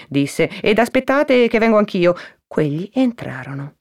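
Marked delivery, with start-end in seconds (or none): 0.6-2.1 calling to visitors. Acted. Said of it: "rivolta ai due fratelli".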